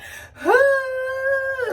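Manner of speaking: put-on high wailing sob like a kid; boo-hoo sob. Retelling her pre-surgery panic, high boo-hoo sob. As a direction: let out one long boo-hoo cry. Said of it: "when I say boo-hoo crying".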